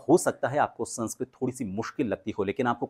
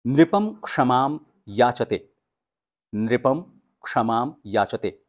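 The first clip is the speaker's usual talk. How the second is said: "नृपम क्षमाम याचते" very slow and loud; slow recitation; 3 dB louder, slower than usual. Shown as a demonstration. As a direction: model each syllable for students. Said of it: "ये वाक्य है".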